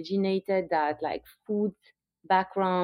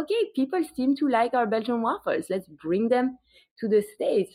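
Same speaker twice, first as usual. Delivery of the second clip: carefree early optimism; 6 semitones higher than usual. Retold or imitated. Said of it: "It was just like".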